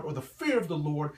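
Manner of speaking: usual speaking voice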